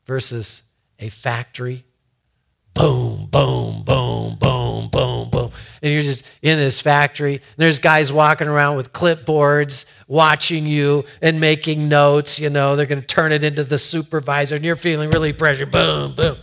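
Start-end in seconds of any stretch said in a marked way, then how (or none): 2.7-5.5 low, evenly repeated booms, louder; heavy mechanical thuds made by voice; imitated factory pounding. 5.8-15.7 loud, fast, relentless run-on; driving, hurried delivery at raised volume; pressured rush. Retold or imitated. Acts out pressure on factory floor. 15.7-16.4 two loud machine booms; heavy vocal booms; imitated factory pounding.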